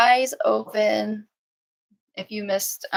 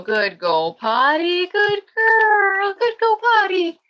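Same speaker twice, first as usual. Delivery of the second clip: squeaky sing-song praise for the puppies, much higher; high puppy praise voice; 12 semitones higher, 5 dB louder, slower than usual. Real, not acted. Praising puppies after potty.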